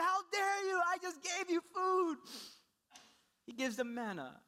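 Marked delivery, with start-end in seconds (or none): 0.0-2.2 high, loud indignant shout; mock divine wrath. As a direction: thunder it as an offended God. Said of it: "instead of God smiting them".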